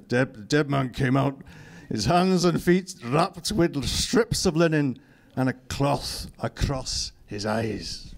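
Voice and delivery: slow, deliberate Scottish reading; Scottish accent. Acted. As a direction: read it in a put-on Scottish accent. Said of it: "Oh, it's in Scottish".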